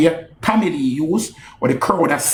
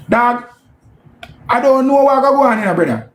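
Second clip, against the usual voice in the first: shocked; 7 semitones higher than usual. Real, not acted. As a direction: react like you can't believe it. Shocked reaction to a diss.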